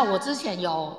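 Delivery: ordinary delivery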